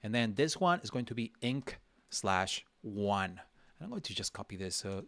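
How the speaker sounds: ordinary talk, nothing marked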